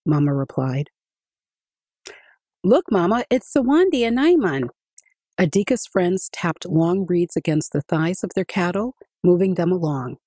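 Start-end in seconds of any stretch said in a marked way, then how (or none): 2.6-4.7 put-on excited boy. Adika points out his friends.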